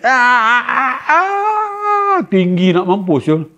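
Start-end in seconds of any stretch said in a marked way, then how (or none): 0.0-2.3 rising siren-like shriek; high-pitched scream. Retold or imitated. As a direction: wail up into a rocket-high screech. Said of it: "Dia jerit". (jeritan nyaring macam siren)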